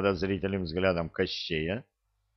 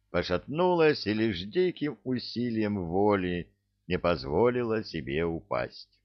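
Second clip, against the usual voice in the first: usual speaking voice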